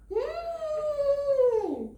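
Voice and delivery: high, long "hmmm" falling at the end; craving hum. Acted. Crocodile is tempted by the elephant, high craving hum. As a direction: hold a high hungry hum, then slide down.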